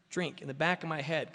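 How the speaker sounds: ordinary talk, nothing marked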